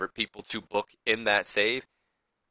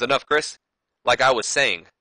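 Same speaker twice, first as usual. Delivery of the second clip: raised, firm voice to shut him up; louder, cutting him off; stern cutoff; 4 semitones higher, 6 dB louder than usual. Retold or imitated. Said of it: "He goes".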